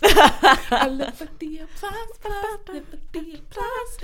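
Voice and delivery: mock diva. Mock repeat of line.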